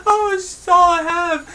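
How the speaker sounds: slow, high falsetto mock sobbing; hammed-up crying woman. Cat owner hears her pet died, slow falsetto mock sobs. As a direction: draw out the owner's fake grief in a squeaky wail. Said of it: "She starts crying".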